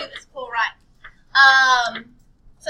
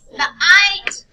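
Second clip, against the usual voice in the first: loud, higher, drawn-out "ite"; emphasis on ite; 6 semitones higher, 13 dB louder than usual. Shown as a demonstration. Teacher pointing students to the ending, emphatic "ite". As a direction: punch "ite" loudly and hold it. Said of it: "The ite turns to us".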